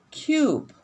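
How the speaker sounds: Cube said high and drawn for the long U; model word. Shown as a demonstration. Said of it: "So what sound is this?".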